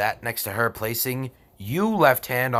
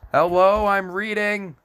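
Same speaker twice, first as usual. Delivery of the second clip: annoyed call-out; 9 semitones higher, 5 dB louder than usual. Real, not acted. Snapping at someone interrupting.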